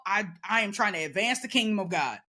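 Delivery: ordinary delivery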